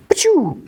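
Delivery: high-pitched imitated blaster shot; blaster shot noise. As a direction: make a sudden "pew" gun sound. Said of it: "She just shoots him".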